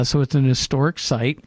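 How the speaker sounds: ordinary talk, nothing marked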